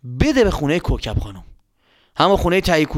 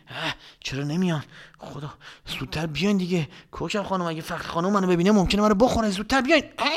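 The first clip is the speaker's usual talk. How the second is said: nervous hushed pleading; 3 semitones higher, 5 dB quieter than usual. Acted. Nervous boy waiting at door.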